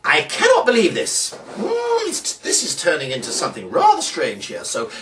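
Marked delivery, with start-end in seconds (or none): 0.0-2.1 louder, much higher burst; mock disbelief. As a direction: play shocked at the cans. Mock shock at trick result.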